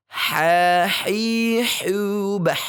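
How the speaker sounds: "Ha, hi, hu, bah" with a clean breathy h; smooth ha drill. Shown as a demonstration. Teacher drills ha for the class, clean and smooth. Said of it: "Kemudian selanjutnya".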